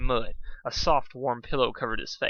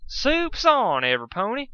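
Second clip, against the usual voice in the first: high, loud dinner call; yelled; 11 semitones higher, 5 dB louder than usual. A narrator's reading. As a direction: holler it across the farm. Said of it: "she yelled in caps lock".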